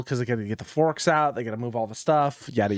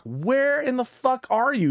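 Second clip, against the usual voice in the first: high, slow, exasperated yell; mock exasperated yell; 11 semitones higher, slower than usual. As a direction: stretch it out, fed up, pitched way up. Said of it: "I call my boss".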